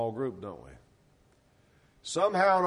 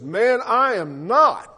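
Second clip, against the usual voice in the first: Peter's firm denial; 7 semitones higher, 8 dB louder, slower than usual. Acted. Peter denies knowing Jesus.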